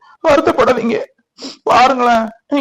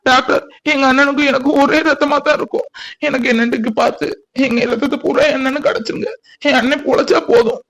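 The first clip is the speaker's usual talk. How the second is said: high, tearful appeal; anguished pleading; 5 semitones higher than usual. Acted. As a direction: beg the doctor in a higher, distressed voice. Sibling begs doctor to save brother. (உருக்கமாகக் கெஞ்சும் குரல்)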